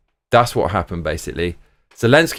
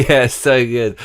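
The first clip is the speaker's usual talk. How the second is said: laughing; 2 semitones higher, slower than usual. Real, not acted. Laughing at the meme.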